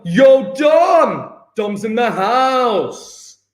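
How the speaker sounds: loud, high hype greeting; excited shout. Real, not acted. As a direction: cheer the student in, slowly. Greeting arriving student.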